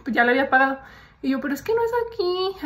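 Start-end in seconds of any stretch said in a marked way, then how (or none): 1.4-2.7 higher, naive little protest; playing up her own meek innocence; innocent, clueless. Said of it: "Así bien, bien, bien inocente, bien mensa".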